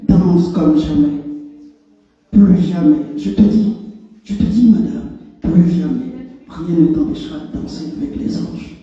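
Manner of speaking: forceful, incantatory poetry reading in short phrases; punched-out refrains, insistent and rhythmic; emphatic poem recitation. Acted. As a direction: declaim it like a chant, pausing between phrases. Recites own poem aloud.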